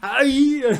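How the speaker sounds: high, loud Austrian yell; Schwarzenegger. Acted. Action star about to speak, mock bellow. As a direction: open with a hammed-up muscleman holler. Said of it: "real good Schwarzenegger by just starting with".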